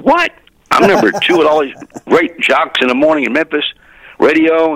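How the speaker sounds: high, stunned disbelief; astonished. Retold or imitated. Young jock hearing he's number two, astonished. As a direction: burst out in surprise at the news. Retold hearing he ranks second.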